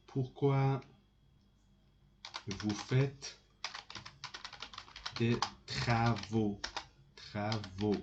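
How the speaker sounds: word groups spaced by long pauses; dictating while writing. Shown as a demonstration. Teacher writing the question for students, slow dictation. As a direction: dole it out piece by piece as you write. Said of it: "I will write for you".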